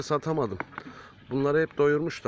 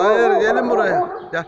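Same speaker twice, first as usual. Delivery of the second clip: very high, loud, slow shout; 11 semitones higher, 13 dB louder, slower than usual. Real, not acted. Shouting at the animals to come back here. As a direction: yell them back before they run off.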